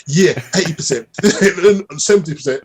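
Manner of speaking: a quick Cockney fan's guess, lightly mocked; Cockney accent. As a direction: imitate a Cockney football fan guessing. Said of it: "Villa fan speaks with a, with a Cockney accent".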